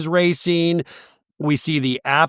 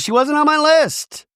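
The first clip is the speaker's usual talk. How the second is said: high-pitched quoted yell; hammed-up shrieky retort; mock outburst; 13 semitones higher, 4 dB louder than usual. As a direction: shoot way up in pitch, imitating an indignant comeback. Acts out Kay's angry comeback.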